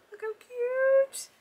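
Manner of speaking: high, slow coo of delight; drawn-out gushing coo. Real, not acted. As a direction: melt over something adorable. Gushing over a gift ornament.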